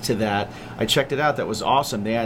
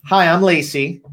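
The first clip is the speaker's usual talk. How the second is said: loud demo; 6 semitones higher, 6 dB louder than usual. Shown as a demonstration. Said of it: "But don't yell it".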